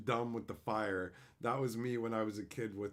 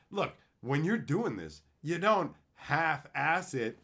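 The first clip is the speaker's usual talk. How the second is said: ordinary talk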